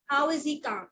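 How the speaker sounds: ordinary talk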